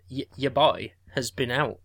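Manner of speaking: ordinary talk, nothing marked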